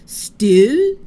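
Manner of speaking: loud disbelieving shopkeeper; mock surprise. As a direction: punch it like you can't believe it.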